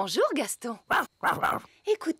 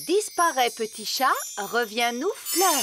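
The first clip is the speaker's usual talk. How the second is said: formule magique solennelle; 4 semitones higher, slower than usual. Acted. Reine lance un sortilège.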